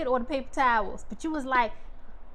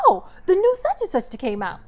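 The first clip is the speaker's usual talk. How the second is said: exasperated, higher; high mock exasperation; 11 semitones higher than usual. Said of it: "you be like".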